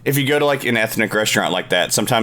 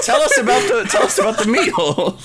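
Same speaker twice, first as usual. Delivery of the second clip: asking while laughing hard, pitch way up; giggling request for the story; 11 semitones higher than usual. Real, not acted. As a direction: barely get the words out through laughter. Asks through laughter.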